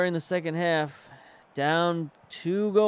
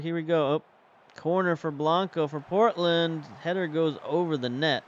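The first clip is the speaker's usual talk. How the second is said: normal talking voice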